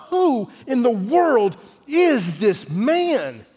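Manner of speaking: high, very slow, drawn-out question; emphatic wonder. Acted. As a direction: stretch every word out in big astonishment. Preacher poses the central question.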